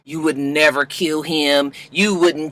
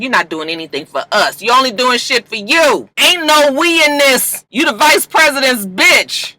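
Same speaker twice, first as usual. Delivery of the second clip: angry wife; 7 semitones higher than usual. Retold or imitated. Wife snaps back at husband.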